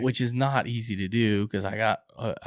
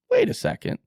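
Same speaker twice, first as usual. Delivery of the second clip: high-pitched, startled double take; retold sudden realization; 5 dB louder than usual. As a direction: jump up high in startled disbelief. Acts sudden discovery on the arrow.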